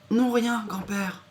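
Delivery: put-on high little girl reply; squeaky girl voice; young girl voice. Girl character answers. (voix de petite fille)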